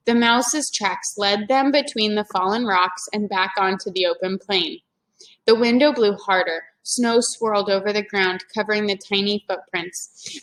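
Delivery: ordinary delivery, nothing marked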